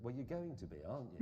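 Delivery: ordinary delivery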